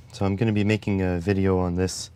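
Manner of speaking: ordinary talk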